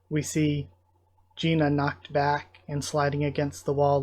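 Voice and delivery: usual speaking voice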